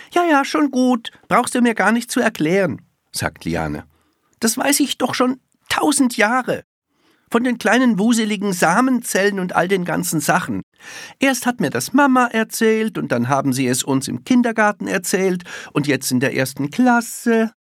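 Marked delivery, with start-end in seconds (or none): 0.1-2.8 high, loud, quick brush-off; impatient child's voice, pitched up; know-it-all little girl. A narrator's reading. 4.4-17.5 put-on high, quick child rattling off what she knows; small girl boasting, pitched right up; high, loud-ish precocious chatter; know-it-all little girl, impatient. A narrator's reading. Little girl's held dialogue voice.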